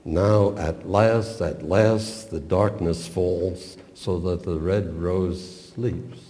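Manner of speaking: slow, leaning on "Now" and "last"; slow intonational stress. Shown as a demonstration. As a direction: linger on "at last, at last". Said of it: "the keynote term is now".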